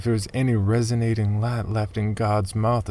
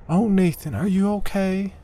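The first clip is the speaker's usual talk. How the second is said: put-on high, worried young woman's voice; anxious woman on the edge of panic, much higher; Margaret; 12 semitones higher than usual. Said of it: "Margaret asked".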